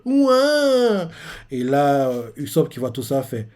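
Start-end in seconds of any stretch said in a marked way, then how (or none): 0.0-1.1 high, loud mock "ouin" cry; cartoon wail. Lilith wailing as she starts to cry, mock sob. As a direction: wail like a crying cartoon kid. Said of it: "on la voit qui commence à pleurer".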